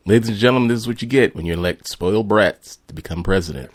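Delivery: mock announcer.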